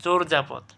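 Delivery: চোরযাপদ as "chor-japod"; correct pronunciation. Shown as a demonstration. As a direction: round the first vowel of চোরযাপদ to "o". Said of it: "সঠিক উচ্চারণ কী হবে?". (চোরযাপদে "চোর্" ও বর্গীয় জ)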